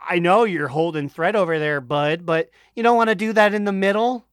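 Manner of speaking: ordinary delivery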